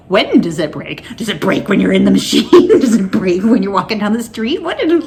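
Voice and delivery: laughing questions. Real, not acted. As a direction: giggle in disbelief at the news. Laughing at the radiologist's news.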